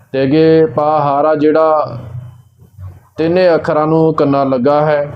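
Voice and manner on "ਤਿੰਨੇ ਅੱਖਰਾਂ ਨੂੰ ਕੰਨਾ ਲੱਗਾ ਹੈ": ordinary delivery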